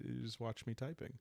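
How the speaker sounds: usual speaking voice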